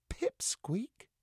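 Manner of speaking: high unvoiced whisper; disbelieving whisper. A narrator's reading. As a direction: whisper it in horror. Dismayed whisper.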